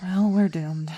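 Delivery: ordinary delivery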